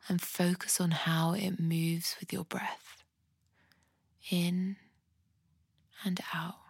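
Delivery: soft, unhurried body-scan voice with long pauses; guided meditation. Acted. Podcast host guiding listeners through a compassionate body scan, soft meditation voice. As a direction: guide them gently through the body, leaving space to breathe.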